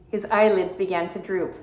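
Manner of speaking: ordinary delivery, nothing marked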